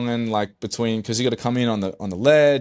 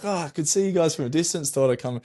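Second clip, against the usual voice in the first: lightly voiced breezy stranger, quick and higher; random Aussie dude; 7 semitones higher, faster than usual. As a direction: imitate his casual, offhand answer. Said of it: "this random Aussie solo warrior dude".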